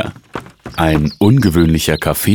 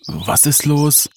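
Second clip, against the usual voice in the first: slow, high-pitched drowsy question; very sleepy; 10 semitones higher, slower than usual. A narrator's reading. Brother just woken by his sister, very sleepy. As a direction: drag it out, barely awake. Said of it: "fragte Michael noch völlig verschlafen".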